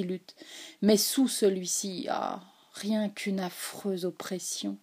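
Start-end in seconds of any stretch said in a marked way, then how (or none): none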